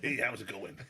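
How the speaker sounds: put-on loud, laughing stab at an American accent; bad American accent. Imitating a strongman for the hosts, bad American accent. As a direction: badly fake an American greeting. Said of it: "I can't do an American accent".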